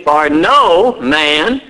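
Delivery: "no man" drawn out very slowly with weight; emphatic NO MAN. Shown as a demonstration. Preacher showing the congregation the emphatic words, stressed NO MAN. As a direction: lean long and hard on "no man". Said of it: "that's emphatic there in that first part".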